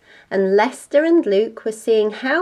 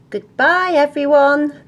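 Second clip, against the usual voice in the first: high, loud cheery goodbye; called-out farewell; 7 semitones higher, 7 dB louder than usual. Acted. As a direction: sing out a goodbye to the household. Parents call farewell in story.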